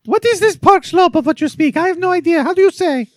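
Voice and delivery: very high, loud caricature voice; sister-in-law impression. Sister-in-law questions the Nepalese intern, high loud caricature. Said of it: "having a conversation with my sister-in-law".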